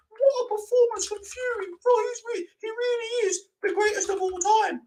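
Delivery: high-pitched mock gushing over a fighter; hyped-up fan. Streamer showing what he won't say about Fury, mock over-excited. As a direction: parody breathless hype, held very high throughout. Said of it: "You don't hear me saying".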